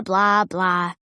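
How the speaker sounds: ordinary delivery, nothing marked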